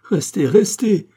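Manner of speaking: high, loud acted plea; pleading cry. A narrator's reading. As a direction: cry out, begging the lover to stay. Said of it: "cria Catherine".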